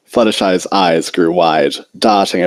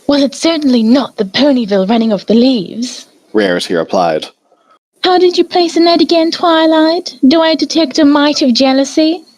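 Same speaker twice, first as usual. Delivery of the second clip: teasing Rarity. A narrator's reading. Rarity needling Twilight about jealousy, fast high teasing. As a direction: poke fun with mock sweetness.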